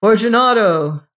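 loud, high call of Fortunato!; calling aloud. Acted. Montresor calling to the walled-in man: loud, high. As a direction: call into the niche. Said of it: "I called again".

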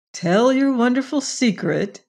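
plain reading voice

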